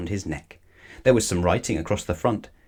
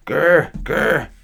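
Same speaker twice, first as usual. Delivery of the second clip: louder muffled spluttering growl; spluttered grr; 6 semitones higher, 5 dB louder than usual. A narrator's reading. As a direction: splutter angrily through a mouthful. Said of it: "spluttered Missus Bird".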